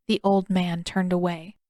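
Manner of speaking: plain reading voice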